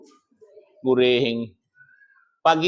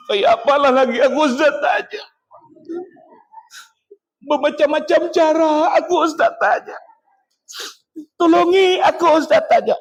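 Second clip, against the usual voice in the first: high, wailing imitation of a tearful plea; hammed-up sobbing plea, pitched way up; mock crying; 9 semitones higher than usual. Wife begs the ustaz for help, imitated crying. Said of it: "Nangis sanange-nangisnya di hadapan aku".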